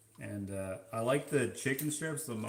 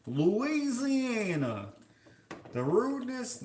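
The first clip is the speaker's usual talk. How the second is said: high, stretched mock Louisiana drawl; hammed-up Louisiana accent; 9 semitones higher, 3 dB louder, slower than usual. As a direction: swoop up high in a put-on Louisiana twang. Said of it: "Oh, the accent".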